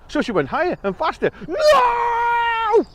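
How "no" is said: very high, loud drawn-out no; high terrified scream. Acted. Mouse screams as the bird flies faster.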